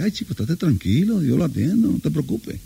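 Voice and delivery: calm him down gently; reassuring wife. Retold or imitated. Wife calmly reassures worried husband.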